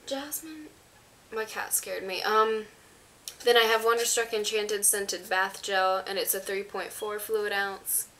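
ordinary delivery

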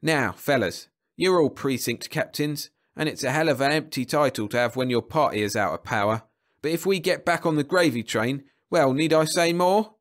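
booming speech. A narrator's reading. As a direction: boom it out to a hall of party men. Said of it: "hearing the booming voice".

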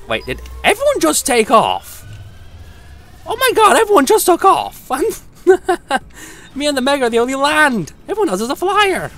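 high, loud laughing disbelief; giddy disbelief. Real, not acted. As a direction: shriek in amused shock. Teammates all flew off.

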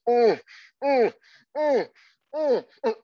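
high, coy mm sounds of lovers caught in the act; playful imitation of lovemaking noises; mock moaning. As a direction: hum suggestive little moans, rising. Police break in on lovers.

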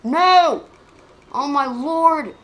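loud cry, then a slow groan; real gamer's loud despair; despairing yell. Real, not acted. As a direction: give up in loud dismay. Failing again in game.

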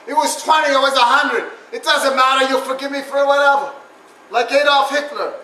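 ordinary talk

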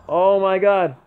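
loud, high shouting oh my god; ecstatic screaming. Real, not acted. Gamer screaming at the clutch step back, loud and high. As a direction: lose it over the shot.

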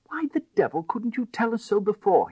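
high, loud exasperation; angry cry. A narrator's reading. Crowley scolding the narrator before dashing off, angry cry. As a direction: shout it in frustration. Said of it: "he cried".

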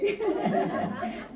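breathy giggle; high airy laugh. Real, not acted. Teacher laughing at her own joke to the audience, lightly.

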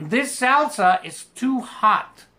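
This salsa is too hot said slowly, word by word; slow model sentence. Shown as a demonstration. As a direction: read it slowly for the class. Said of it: "Vean este otro ejemplo". (lectura lenta y clara para la clase)

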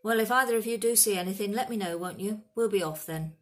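loud, hurried sign-off; grumpy. A narrator's reading. Hagrid takes leave of the centaurs, grumpy and loud. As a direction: wrap it up brusquely and loudly. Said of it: "said Hagrid grumpily".

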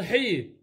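حي said as a long "hii"; ha with kasra. Shown as a demonstration. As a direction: sound out حي with a drawn-out ee vowel. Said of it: "حرف الحاء اذا بالكسره".

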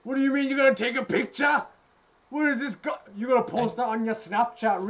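high, loud disbelieving yell; furious gamer shouting at his opponent; outraged shout. Real, not acted.

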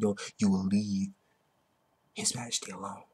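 soft, low whispered warning; hushed cool threat. Retold or imitated. Uryu's line again whispered.

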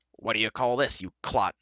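mild, irritable growl; gruff, scornful challenge. A narrator's reading. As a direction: growl the rebuke at him. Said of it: "Dolly growled".

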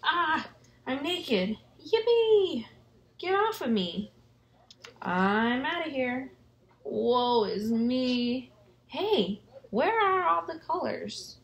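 high, lively cartoon crayons; squeaky excited crayon chatter; excited crayon voices.

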